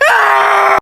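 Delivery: high, loud scream of fright. Acted. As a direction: shriek in terror. Scared scream at ghost.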